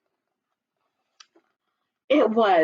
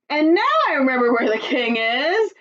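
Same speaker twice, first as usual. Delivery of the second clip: said with a smile; 5 semitones higher, 3 dB louder than usual. Acted. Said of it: "said with a smile".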